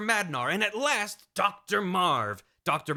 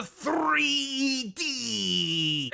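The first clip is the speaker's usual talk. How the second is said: very slow, crying out the words; mock anguished wail; 8 semitones higher than usual. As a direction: moan it in comic despair. Dread about 3D era.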